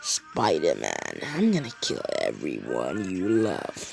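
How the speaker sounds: villain threat. Acted. Green Goblin threatens the pinned Spider-Man in a low villain voice.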